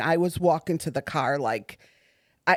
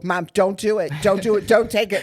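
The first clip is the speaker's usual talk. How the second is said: rising, louder pleas not to take it; pleading shout; 4 semitones higher, 6 dB louder than usual. Retold or imitated. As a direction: beg louder on each "don't". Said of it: "They go".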